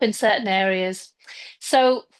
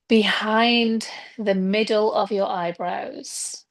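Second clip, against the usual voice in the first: guided meditation. Acted. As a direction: ease them gently into stillness, leaving space between phrases. Leads a breathing and visualization meditation.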